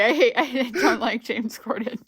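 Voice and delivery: ordinary talk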